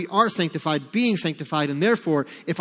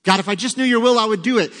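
pleading excuse; 5 semitones higher, 6 dB louder, faster than usual. Retold or imitated. Mock excuse to God.

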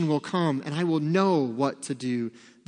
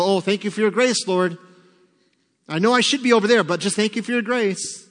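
mock complacent prayer; 4 semitones higher, 4 dB louder than usual.

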